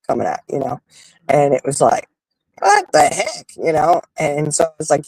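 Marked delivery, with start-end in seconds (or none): 2.6-3.5 startled disbelief. Retold or imitated. Both of them being come at.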